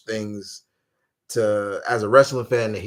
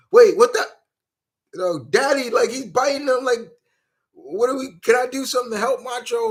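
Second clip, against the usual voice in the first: put-on kid voice; 12 semitones higher, 3 dB louder than usual. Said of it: "you're like".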